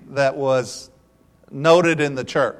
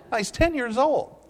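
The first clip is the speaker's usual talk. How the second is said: ordinary talk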